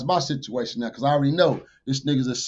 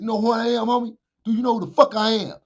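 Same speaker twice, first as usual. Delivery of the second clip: high-pitched, pushy repeated question; retold angry demand; 8 semitones higher than usual. Banging on the rapper in his car, a high angry demand. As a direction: press him hard, building heat.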